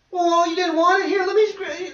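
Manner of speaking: high-pitched, hammed-up fussing; mock coddling parent. Parent giving in to an upset child, mock fussing.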